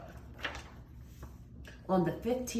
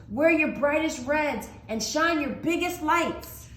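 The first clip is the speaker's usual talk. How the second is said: calling out; 10 semitones higher, 6 dB louder than usual. Acted. Mai commands the villagers.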